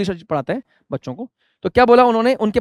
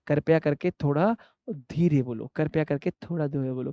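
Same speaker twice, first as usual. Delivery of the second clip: very quiet, lowered voice; hushed, low teacher voice; overly soft demo; 5 semitones lower, 10 dB quieter than usual. Shown as a demonstration. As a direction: speak gently and low, almost fading away. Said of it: "इतना भी धीरे नहीं".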